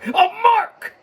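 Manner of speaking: loud high shout of mark. Real, not acted. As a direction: yell it out proudly. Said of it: "That's right, I said a mark".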